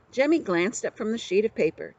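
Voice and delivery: ordinary delivery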